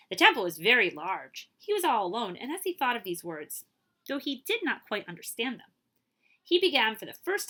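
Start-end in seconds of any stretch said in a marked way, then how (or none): none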